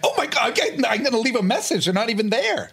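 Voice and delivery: very high, thrilled voice; giddy excitement. Retold or imitated. Panelist mimics excited early answering-machine user, very high. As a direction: squeal it like leaving a message is a thrill. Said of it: "You're like".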